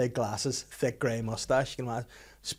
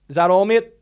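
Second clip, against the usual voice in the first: loud, higher cheery bloke on the phone; hammed-up cheery old fella; hearty phone sign-off; 7 semitones higher, 13 dB louder than usual. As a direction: boom out a breezy goodbye. Old man cheerfully ending call.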